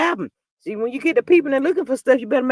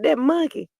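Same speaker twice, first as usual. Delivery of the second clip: ordinary talk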